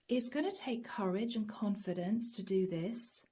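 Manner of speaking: normal talking voice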